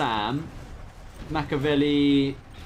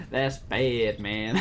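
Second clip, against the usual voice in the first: a drawn-out, lower American "That's bad, man"; long American "that's bad"; 4 semitones lower, much slower than usual. Caster repeating Boyka's long American drawl. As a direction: drag the vowels in an American accent. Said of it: "he did a very long".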